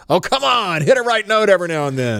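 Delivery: loud, high-pitched heckler yell; exaggerated exasperated shout from the crowd; mock heckling. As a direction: yell it like a fed-up festival heckler. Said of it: "all the things that should be said".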